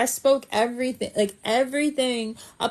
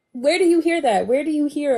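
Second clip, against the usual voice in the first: louder, higher disbelieving question; where do you hear that, amazed; can't believe it; incredulous; 4 semitones higher, 5 dB louder than usual. Real, not acted. Disbelief at advisor paying.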